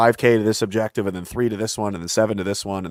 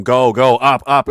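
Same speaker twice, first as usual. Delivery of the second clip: louder, pushing encouragement at a trainee; mock gym shout of go, go; coach yelling; 3 semitones higher, 6 dB louder, slower than usual. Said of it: "You start yelling at them".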